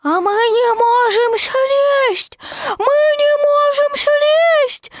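put-on high, drawn-out childlike holler; Roo shouting. A narrator's reading. Roo calls down from the tree, shouting. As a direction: shout down slowly, high and loud. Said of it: "кричал Ру".

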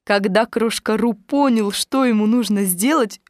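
usual speaking voice

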